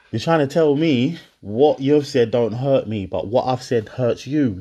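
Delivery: usual speaking voice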